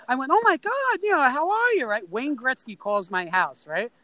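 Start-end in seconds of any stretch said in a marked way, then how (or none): 0.3-1.9 put-on very high, gushing young-fan squeal; starstruck excitement. Kid realising his idol has called the house, acted starstruck. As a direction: re-enact a kid losing it over his idol.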